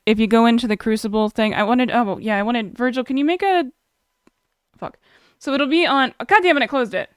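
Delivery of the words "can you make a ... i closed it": ordinary talk, nothing marked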